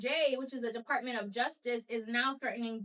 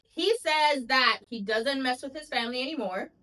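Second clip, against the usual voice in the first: ordinary delivery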